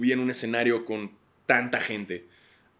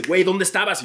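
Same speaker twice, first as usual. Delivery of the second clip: urgent producer; 7 semitones higher, 5 dB louder than usual. Retold or imitated. Producer voiced in story.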